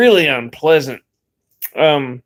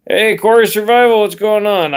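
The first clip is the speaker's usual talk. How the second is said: calling out; 7 semitones higher, 5 dB louder than usual. Real, not acted. Greeting a friend on camera.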